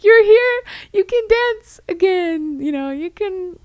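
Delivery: excited party welcome; joyful welcome. Pretend. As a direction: call out like family welcoming her home. Imagined relatives welcoming grandma.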